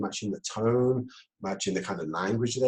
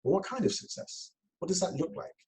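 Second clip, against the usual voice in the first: high, soft curious ask; curious question; 8 semitones higher, 5 dB quieter than usual. Shown as a demonstration. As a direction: question yourself gently. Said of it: "lazy Jedi questions".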